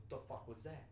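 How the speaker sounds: soft first reaction; retold quiet puzzlement. Man filming outside glimpses a cat run by, quiet puzzlement.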